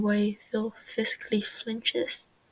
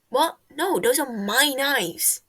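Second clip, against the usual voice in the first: indignant protest; 3 semitones higher, 7 dB louder, slower than usual. Acted. Techno indignant at Wilbur.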